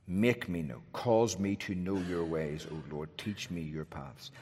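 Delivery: soft, low murmured plea; softly spoken prayer to the Lord; hushed prayer. Acted. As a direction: pray it under your breath, humbly. Quiet prayed verses.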